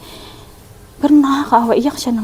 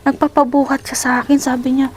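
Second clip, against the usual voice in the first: ordinary delivery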